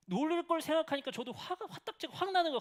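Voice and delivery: mock anger. Father recalling his anger at the teasing, mock heated. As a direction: act out getting hot-headed. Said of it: "화가 나는 거예요".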